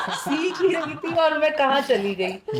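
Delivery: laughing through the words, pitch jumping up; amused disbelief, laughing as she speaks. Retold or imitated. Laughing as she tells the interviewer she was thrown into fasting. Laughing at her own shock.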